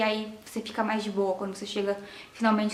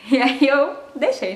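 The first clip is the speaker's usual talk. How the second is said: normal talking voice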